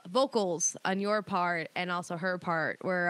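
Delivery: ordinary talk, nothing marked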